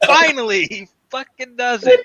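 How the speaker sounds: high, loud joyful outburst; delighted fan. Retold or imitated. Fan celebrating Bond finally doing it, high delighted shriek. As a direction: shriek with glee. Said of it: "own excitement retold".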